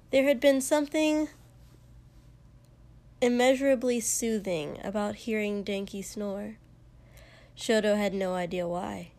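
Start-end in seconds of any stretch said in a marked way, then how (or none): none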